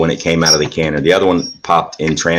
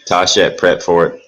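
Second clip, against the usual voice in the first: normal talking voice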